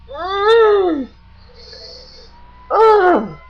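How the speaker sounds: high, strained groan of pain; retold groan as a sharp ear pain hits. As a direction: let out a sudden pained moan. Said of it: "I did that".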